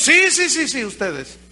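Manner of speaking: high loud insistence that fades at the end; emphatic shout. Retold or imitated. John the Baptist insisting to the crowd, a fading shout. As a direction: point right at them, yes you.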